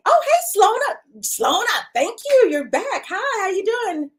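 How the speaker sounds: light up at the name; excited greeting. Real, not acted. Host greeting a returning viewer with delight.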